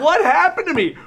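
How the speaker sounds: high, loud, laughing disbelief; laughing panic. Retold or imitated. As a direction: laugh through the shock.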